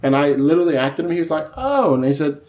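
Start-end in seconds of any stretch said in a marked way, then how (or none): 1.6-1.9 high-pitched "oh" of sudden delight; impressed realization. Retold or imitated. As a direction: imitate a listener who just got it. Acts out impressed producer's reaction.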